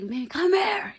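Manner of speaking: high, loud yell across a room; imitated yell. Man yelling across Tootsie's at the woman walking off, imitated holler. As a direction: holler to call someone back. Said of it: "yelling across the motherfucking Tootsies".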